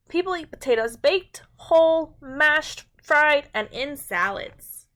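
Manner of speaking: bright, clear storybook read-aloud; reading to children. A narrator's reading. Teacher reads a food book to her class, storybook voice.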